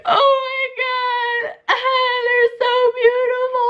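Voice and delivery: very high, slow happy wail; squealed, drawn-out mock bawling; hammed-up crying. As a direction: re-enact sobbing with overwhelmed joy. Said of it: "really crying".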